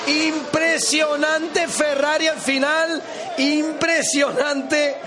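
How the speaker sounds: drawn-out exclamation. Real, not acted. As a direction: stretch each word in amazement.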